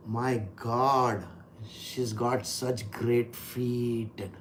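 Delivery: low, soft, slow awestruck murmur; Brahmaji's inner thoughts. Retold or imitated. Brahmaji admiring Parvati's feet, low slow murmur. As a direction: savour each word under the breath. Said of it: "He's thinking like that in his mind".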